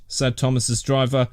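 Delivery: ordinary delivery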